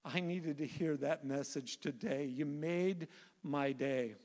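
a hint of tears as you retell it; tearful nurse, retold. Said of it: "with tears coming down her face".

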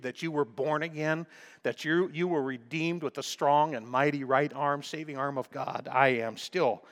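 ordinary delivery

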